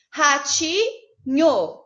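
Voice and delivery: Ratinho said very slowly with a break in the middle; syllable-split ratinho. Shown as a demonstration. Teacher showing the split of ratinho, very slowly. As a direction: stretch Ratinho out and pause at the split. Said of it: "Separa em duas".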